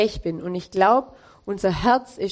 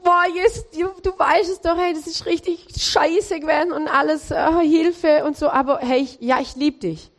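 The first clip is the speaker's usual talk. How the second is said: much higher, flustered Peter pleading; acted Peter; 7 semitones higher than usual. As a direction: Peter blurting his love, pitched up and rattled. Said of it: "Und der Petrus".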